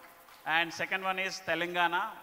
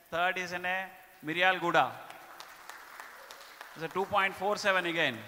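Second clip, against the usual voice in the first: loud, upbeat awards announcer; award announcement; 4 dB louder than usual. Acted. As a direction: announce the winners to the room. Announcing top states and branches.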